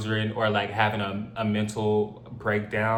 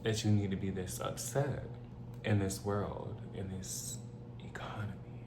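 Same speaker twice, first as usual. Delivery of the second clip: trailing off into a soft unvoiced aside; softer and softer until only breath; fading to a whisper; 3 semitones lower, 12 dB quieter than usual. Real, not acted. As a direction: let it die away to a whisper on economy.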